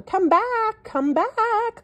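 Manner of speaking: high, loud call. A narrator's reading. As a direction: shout after the fleeing elephant. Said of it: "called the hungry crocodile".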